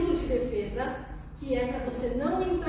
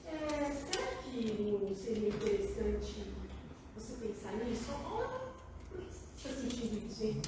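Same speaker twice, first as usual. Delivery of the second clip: gentle questioning; 4 semitones lower, 8 dB quieter than usual. Shown as a demonstration. Helper turning pressure into questions, gentle and low. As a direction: ease the question in softly and unhurriedly. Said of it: "você transforma isso em perguntas".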